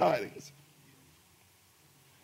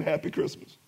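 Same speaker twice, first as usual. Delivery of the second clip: whispered greeting; 4 semitones lower, 6 dB quieter than usual. Shown as a demonstration. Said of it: "nobody in here probably told you".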